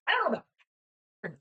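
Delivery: high-pitched chirpy character; chipper chirping voice. Acted. Robot's throat voice admits not knowing, chipper. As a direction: pipe it up high, like a little chirper. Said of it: "a chipper voice kinda chirps through my throat".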